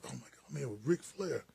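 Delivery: low, soft aside to self; hushed awe. Retold or imitated. Player thinking to himself beside a wrestling star, hushed awe. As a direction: say it under your breath, mildly awestruck.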